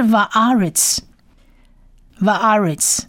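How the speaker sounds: "va'aretz" slowly, as "va-AH-rets"; demonstrated word. Shown as a demonstration. Teacher introduces the third word, "va'aretz" slow and clear. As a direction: say "va'aretz" carefully, then pause and repeat. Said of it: "就是va'aretz".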